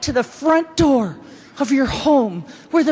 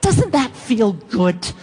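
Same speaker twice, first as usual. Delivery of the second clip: normal talking voice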